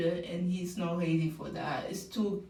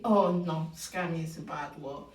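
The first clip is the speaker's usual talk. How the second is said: usual speaking voice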